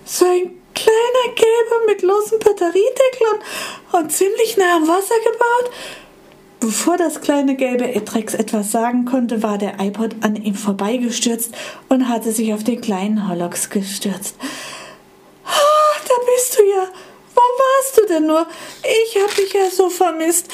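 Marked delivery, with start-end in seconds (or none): 0.0-5.7 high, eager hopeful voice; old iPod hopefully describing his friend; anxious old iPod. Acted. 15.4-20.5 very high, louder joyful cry; old iPod finding its lost friend; overjoyed reunion. Acted.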